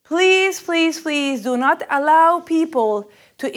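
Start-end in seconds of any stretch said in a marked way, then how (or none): none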